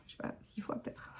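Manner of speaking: a quick unvoiced whispered aside. Real, not acted. Mother whispering a guess to her viewers, hushed. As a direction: drop to a breathy whisper, half to herself.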